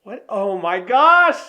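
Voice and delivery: high, loud, stretched-out exclamation with laughter; delighted shock. Real, not acted. Man thrilled by his valentine gift, delighted shock. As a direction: shriek happily through a laugh.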